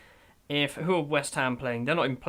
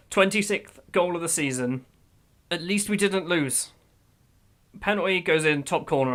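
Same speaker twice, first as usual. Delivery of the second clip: usual speaking voice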